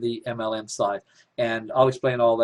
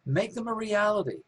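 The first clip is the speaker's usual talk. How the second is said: "Make them a reality" pitched high, rousing, then dropping at the end; emphatic high exhortation; 8 semitones higher, 4 dB quieter than usual. Real, not acted. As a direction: lift it high like a rallying cry. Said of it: "repeats the phrase for emphasis".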